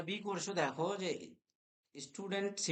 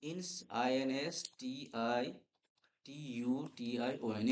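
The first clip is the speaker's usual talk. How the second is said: slow spelling; 3 semitones lower, 3 dB quieter than usual. Shown as a demonstration. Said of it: "লিখে রাখো".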